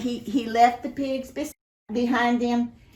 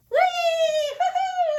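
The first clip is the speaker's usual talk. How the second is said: high laughing whoops of glee; party whoop; 3 dB louder, slower than usual. Acted. Storyteller voicing the party crowd, whooping and laughing. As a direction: cheer like the party started.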